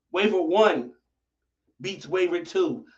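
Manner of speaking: normal talking voice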